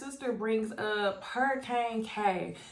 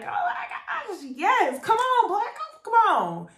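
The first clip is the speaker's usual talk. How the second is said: high, loud hype cheering; 12 semitones higher, 4 dB louder, slower than usual. Retold or imitated. Vlogger cheering the bride on, high and loud. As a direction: hype them up down the aisle.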